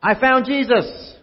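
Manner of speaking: loud, high, drawn-out shout of discovery; excited yell. Retold or imitated. Searcher calling to Joseph and Mary, loud, high and slow. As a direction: yell it like you just found him. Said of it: "whoever saw him first just yelled".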